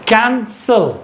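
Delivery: कैंसल split into two beats, first syllable CAN then SAL. Shown as a demonstration. Teacher showing the first syllable sound, कैंसल in two separate beats. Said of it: "हमारे मुंह से first syllable".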